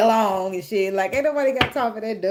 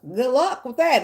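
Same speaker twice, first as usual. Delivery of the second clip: higher, louder, mocking good luck; sarcastic good luck; 7 semitones higher, 4 dB louder, slower than usual. Real, not acted. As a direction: toss the wish off with mock sweetness. Mocking a partner who plays games.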